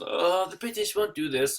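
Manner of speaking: a hint of hammed-up British; mock British accent. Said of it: "I'm speaking like I'm British".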